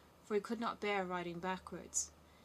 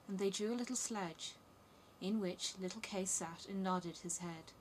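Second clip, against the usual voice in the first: ordinary delivery, nothing marked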